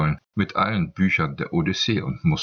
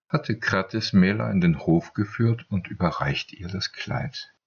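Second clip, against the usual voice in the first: usual speaking voice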